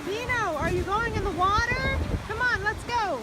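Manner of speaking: high, eager coaxing voice; excited coaxing. Real, not acted. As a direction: egg the dog on. Coaxing the dog.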